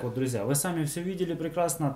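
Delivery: normal talking voice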